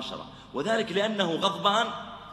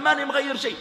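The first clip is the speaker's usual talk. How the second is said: high, loud stubborn snap; angry refusal; 9 semitones higher, 5 dB louder than usual. Acted. Shopkeeper refusing to change it, loud and angry. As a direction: refuse with raised voice.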